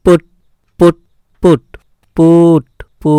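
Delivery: পুট with a u vowel, stretched on the later repeats; u vowel demonstration. Shown as a demonstration. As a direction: repeat পুট, then draw out the "oo". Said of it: "ভালো করে উচ্চারণটা দেখো". (পুট-এর উ স্বরটা শেষে টেনে দেখানো)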